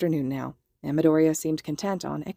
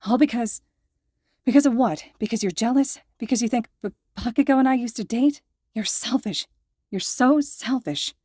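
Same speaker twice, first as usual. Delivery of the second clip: higher and louder, voice straining as the hurt spills over; tearful outburst; 6 semitones higher, 3 dB louder than usual. A narrator's reading. Midoriya turns on Hitoshi, calling him selfish, tearful and angry. Midoriya lashes out, near tears.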